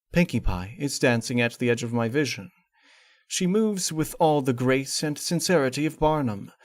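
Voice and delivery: plain reading voice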